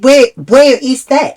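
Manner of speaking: high, loud, drawn-out demand; exasperated question. Retold or imitated. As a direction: ask it loudly and slowly, pitched right up. Retelling her exasperated question to staff.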